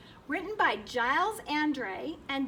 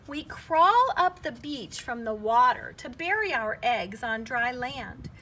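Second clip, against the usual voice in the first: ordinary delivery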